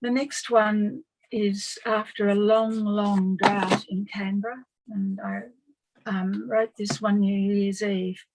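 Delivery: normal talking voice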